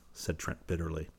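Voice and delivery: plain reading voice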